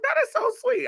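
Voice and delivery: a high gushing coo; gushing falsetto. Retold or imitated. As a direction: coo it like you're melting. Gushing reaction to friend.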